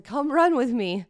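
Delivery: high, loud, slow call across the track. Retold or imitated. Inviting Logan to join her, a loud call. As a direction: shout it to someone far off.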